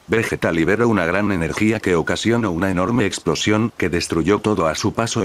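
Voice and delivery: ordinary delivery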